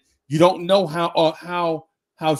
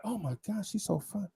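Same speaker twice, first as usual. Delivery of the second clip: much softer and higher, dreamy; swooning inner thought; 5 semitones higher, 11 dB quieter than usual. Retold or imitated. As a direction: sigh it like a smitten thought. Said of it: "don't be like".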